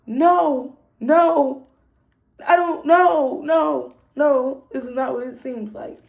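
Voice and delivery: high, loud frantic no's; panicked denial. Retold or imitated. Denying to her boyfriend, high and frantic. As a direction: plead your innocence in a rush.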